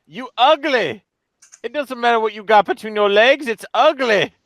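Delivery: usual speaking voice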